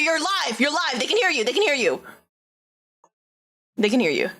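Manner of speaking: ordinary talk, nothing marked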